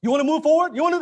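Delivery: mock the salesman begging for the deal; hammed-up eager closer; pushy salesperson. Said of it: "You don't ask".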